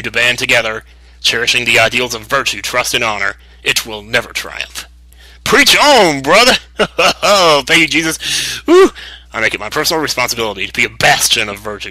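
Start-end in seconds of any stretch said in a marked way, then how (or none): 5.4-8.9 much higher, louder call of approval; hype cheer. Pretend. A player cheering the hero's noble speech, loud and high. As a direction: shout it like a fired-up churchgoer.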